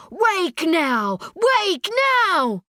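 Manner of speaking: high scream, climbing on the repeat; urgent rising-pitched cries; screaming in broken English. A narrator's reading. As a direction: scream desperately to rouse a sleeper. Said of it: "He screams again in broken English".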